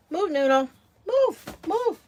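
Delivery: high-pitched, loud order to a dog; short, high calls to a pet; dog command. Real, not acted. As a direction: shoo the dog with sharp rises in pitch. Shooing the dog away.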